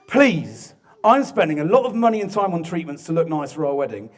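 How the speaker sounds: imitating a fed-up fiancée's angry text; mock angry. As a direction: read out a telling-off with hammed-up indignation. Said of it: "Angry emoji".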